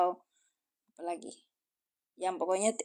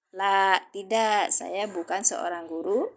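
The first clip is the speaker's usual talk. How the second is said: "La, tidak" drawn out slowly; slow model answer; slower than usual. Shown as a demonstration. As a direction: spell out the answer slowly for learners. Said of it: "jawabnya apa?". (jawaban contoh diucapkan pelan)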